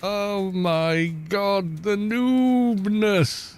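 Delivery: slow, high, stretched groan of disbelief; long high wail at his own noobness; drawn-out dismay. Real, not acted. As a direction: drag it out in mock despair.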